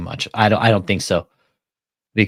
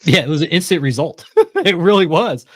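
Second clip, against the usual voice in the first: laughing, louder and higher; 6 semitones higher, 4 dB louder than usual. Real, not acted. Gloating to co-host about George, laughing. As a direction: chuckle through the words.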